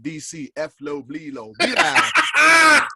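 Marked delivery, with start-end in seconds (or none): 1.6-2.9 much higher and louder drawn-out hail; loud high call to cohost. Real, not acted. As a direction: sing out the cohost's name across the room. Calling out to cohost.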